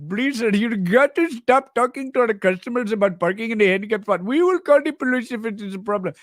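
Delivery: put-on pleading shop owner in a Pakistani accent. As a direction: put on a thick Pakistani accent, protesting to a customer. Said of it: "Pakistani owners of the convenience store".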